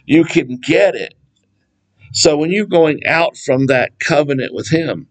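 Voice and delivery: ordinary delivery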